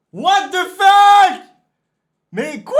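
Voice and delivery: crié beaucoup plus aigu et fort, très lent; étire le cri comme un appel; hurlé et étiré. Real, not acted. Répète la réaction, cette fois hurlée. (hurlé, très aigu et étiré)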